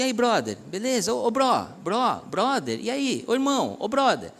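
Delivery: lightly voiced youth calling a friend, rising; a pastor calling a friend out casually; casual friendly calling. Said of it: "a gente chamar assim".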